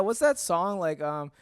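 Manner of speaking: ordinary delivery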